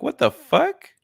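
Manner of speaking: high, startled squeak of a llama. Retold or imitated. Voicing llama pulling away.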